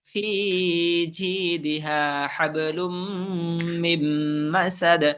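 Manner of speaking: slow, measured tajweed chant; Quran recitation. Shown as a demonstration. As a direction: recite with drawn-out vowels. Recites the surah slowly.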